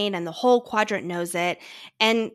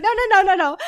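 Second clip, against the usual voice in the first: much higher, much louder run of no's; frantic denial; 11 semitones higher, 9 dB louder than usual. Retold or imitated. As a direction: wave off the rumour in a panic. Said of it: "I was like".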